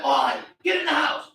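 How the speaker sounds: retold mom yelling from the door; mother's shout. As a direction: call him in like a panicked mom. Mother orders son inside.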